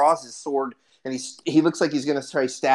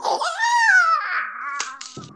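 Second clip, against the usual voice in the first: high, long bird shriek; piercing imitation bird cry; dying bird screech. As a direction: let out one drawn-out squawk of a struck bird. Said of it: "you hear the bird go".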